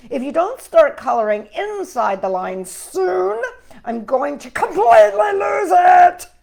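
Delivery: loud, fussy crayon losing patience, ending in a shout; tense Purple Crayon. Acted. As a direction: scold him and build to a full shout. Purple Crayon's angry letter.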